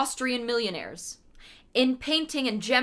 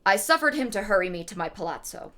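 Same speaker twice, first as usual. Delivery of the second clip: usual speaking voice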